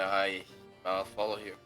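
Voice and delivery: ordinary talk, nothing marked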